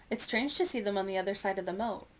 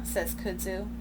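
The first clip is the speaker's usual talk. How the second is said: ordinary delivery, nothing marked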